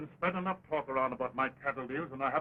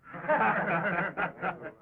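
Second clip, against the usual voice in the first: hearty laugh; 3 semitones higher than usual. Acted. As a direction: laugh it off, big and open. Saloon banter before showdown.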